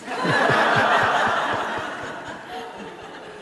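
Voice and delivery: high burst of real laughter. Real, not acted. Speaker laughs at his own punchline, high real laugh. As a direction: crack up laughing at the joke.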